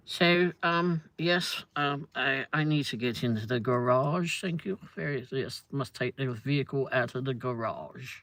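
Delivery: lightly hammed posh English accent. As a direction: put on a posh Wimbledon accent. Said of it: "talk with a posher accent".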